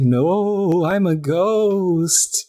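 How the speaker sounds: high, drawn-out mock-ghost wail; spooky sing-out, stretched and high; pretend ghost. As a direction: wail it slowly like a kid playing ghost. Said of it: "[name] is pretending to be a headless ghost".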